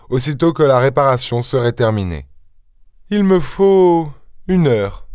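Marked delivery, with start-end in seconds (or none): 3.1-5.1 high, slow and drawn out; deliberate declaration. A narrator's reading. Gentleman setting his terms, deliberate. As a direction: weigh each word, pitched up.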